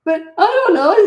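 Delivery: put-on very high, loud whine of a little kid; whining child. Child whining to an indulgent dad, high loud whine. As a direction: wail it like a spoiled kid.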